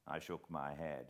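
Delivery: usual speaking voice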